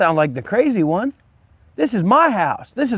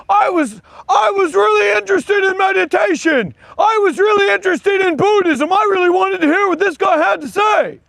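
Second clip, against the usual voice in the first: mocking whiny quote; 9 semitones higher than usual. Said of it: "I'm sure you did".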